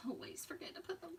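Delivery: quick whispered confession to herself; whispered aside. Real, not acted. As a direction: breathe it out fast, half to herself. Crafter muttering an aside.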